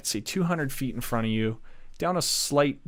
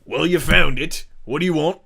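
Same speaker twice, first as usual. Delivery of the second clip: louder, higher suspicious guard; gruff guard; 5 semitones higher, 12 dB louder than usual. Acted. Guard questioning the party at the gate, loud. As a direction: challenge the strangers from the wall.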